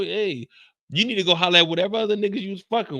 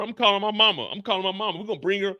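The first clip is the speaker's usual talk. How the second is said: ordinary delivery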